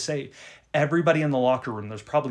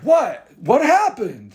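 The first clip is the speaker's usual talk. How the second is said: loud, high puzzled what; confused loud question; 9 semitones higher, 11 dB louder, slower than usual. Retold or imitated. Friend walks in, asks the laughing boys loudly what happened. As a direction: call out baffled and loud. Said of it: "he's like".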